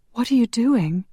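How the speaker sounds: high, worried question; whispered. A narrator's reading. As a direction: ask high and anxious, only lightly hushed. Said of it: "she whispered".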